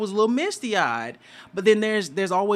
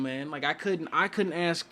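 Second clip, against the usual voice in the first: usual speaking voice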